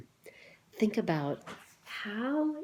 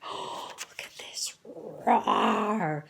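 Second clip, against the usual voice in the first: whisper; much slower than usual. A narrator's reading. Excited at big roar.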